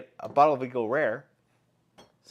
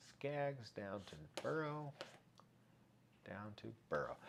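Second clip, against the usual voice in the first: muttering names; 17 dB quieter, slower than usual. Real, not acted. Muttering while reading names.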